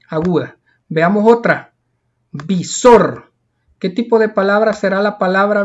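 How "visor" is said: visor with a strong -SOR; stress on last syllable. Shown as a demonstration. Teacher presenting visor for pupils to classify, stressed last syllable. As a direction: punch the second syllable of visor. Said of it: "Lleva la mayor fuerza de voz".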